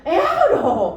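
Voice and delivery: seruan kaget ibu zaman dulu, keras dan setengah bercanda; kaget pura-pura marah. Retold or imitated. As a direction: tiru ibu kolot yang kaget, keras sambil tertawa. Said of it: "kata orang pasti udah marah". (ibu kolot kaget melihat baju seksi, berseru keras sambil tertawa)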